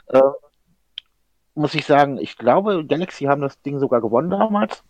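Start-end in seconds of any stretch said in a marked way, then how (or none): none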